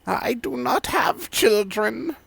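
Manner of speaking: put-on very high, slow old lady denying; indignant old granny, drawn out; high, slow old woman voice.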